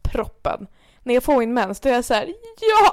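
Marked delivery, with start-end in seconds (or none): 2.6-2.9 high, loud burst of joy; exultant cheer, pitched way up; overjoyed relief. Retold or imitated. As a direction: act out elated relief. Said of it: "då kan jag typ börja gråta".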